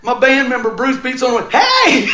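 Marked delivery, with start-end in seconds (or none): 1.5-2.1 loud high "Hey!"; calling out. Retold or imitated. Band member banging on the door, a loud called "Hey!".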